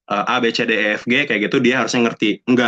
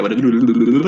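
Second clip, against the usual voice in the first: terlalu cepat; 4 dB louder, faster than usual. Shown as a demonstration. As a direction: rattle it off too fast to follow. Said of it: "ngomong terlalu cepat". (ngomong terburu-buru sampai kata-katanya jadi racauan)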